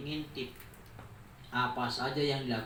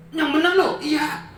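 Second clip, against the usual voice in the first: shocked disbelief; 13 semitones higher, 12 dB louder than usual. Acted. Father shocked by gossip.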